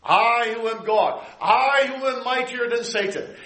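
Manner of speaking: high, slow, every "I" weighted; voice of God. Acted. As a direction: speak as the Almighty himself. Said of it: "the I is emphatic".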